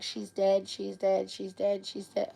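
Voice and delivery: hushed retelling of a shocked chant; retold stunned repetition. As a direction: repeat it quietly, over and over. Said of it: "I was just like".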